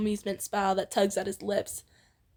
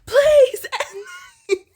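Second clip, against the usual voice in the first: laughing, high, loud mock wail; hammed-up crying plea, half laughing; 14 semitones higher, 8 dB louder, slower than usual. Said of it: "Denki wails again".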